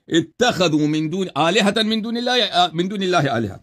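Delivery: slow, drawn-out Arabic recitation; Quran recitation. Acted. Scholar re-reciting the verse correctly to debaters, slow recitation. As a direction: draw out each word of the verse.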